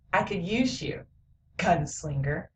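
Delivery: acted low-key hero, softly; quiet offer. Voices hero's tender offer to boy.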